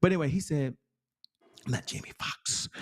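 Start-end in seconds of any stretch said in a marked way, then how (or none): 1.7-2.7 hushed, half-voiced aside; a quick whispered disclaimer. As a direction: whisper it as a throwaway joke. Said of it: "I can't emulate his voice".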